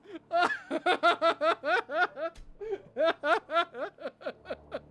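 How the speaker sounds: giddy laugh that slowly fades; prolonged victory laughter, trailing away; long relieved laughter. Real, not acted. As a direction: laugh it out until the breath is gone. Laughs after winning.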